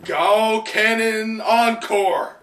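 loud high shout, the attack name drawn out slowly; battle cry. Retold or imitated. Frankie fires at Sasuke, shouting the attack name slowly. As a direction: yell the attack name like an anime hero. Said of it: "Frankie turns around and goes".

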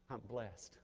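hushed and low, a crippled man's calm; wounded Jacob, quiet. Retold or imitated. Jacob to his sons after wrestling the angel, hushed and calm. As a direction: say it softly, spent but at peace. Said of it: "And he said".

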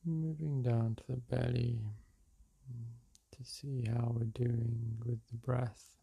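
unhurried meditation-guide voice with long pauses; guided meditation. Real, not acted. Meditation guide leading listeners through breath and release, calm and unhurried. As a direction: guide them gently through each breath, leaving space between phrases.

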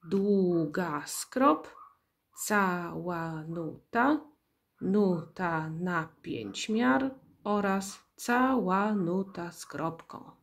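slow rhythmic recital of note names; drawn-out, measured teaching demo; syllable by syllable. Shown as a demonstration. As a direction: recite each name slowly, one beat per syllable. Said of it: "Czyli jeszcze raz".

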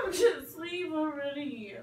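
crying. Real, not acted. Friend crying at goodbye.

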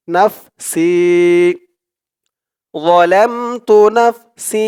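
নাফসি with the si drawn out; slow chanted যালামতু নাফসি; long si. Shown as a demonstration. Teacher reciting the phrase with a long si. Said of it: "সি বলে টান দিতে হবে".

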